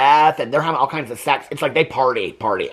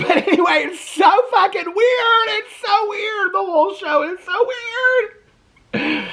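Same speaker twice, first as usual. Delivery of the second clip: incredulous laughing squeal. Real, not acted. Host recapping a baffling show, laughing squeal.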